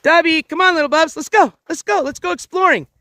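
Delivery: high, eager urging; upbeat dog call. Real, not acted. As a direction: call the dog along with playful energy. Calling the dog to come exploring.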